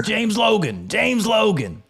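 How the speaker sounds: high, emphatic imitation of an introduction; retold calling out. Imitating his friend announcing him to a buddy, high retold call. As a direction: call the name out high, twice over. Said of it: "looks at his buddy and he goes".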